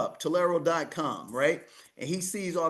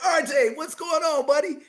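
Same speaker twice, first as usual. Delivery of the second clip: high, loud hey-buddy call; calling out to R J on WhatsApp, high and bright; cheerful greeting; 11 semitones higher, 5 dB louder than usual. Retold or imitated. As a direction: greet an old friend warmly, pitched up.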